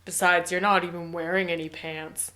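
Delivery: a hint of a smooth creep; lightly voiced sleazy man hitting on her; sleazy guy. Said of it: "And he goes".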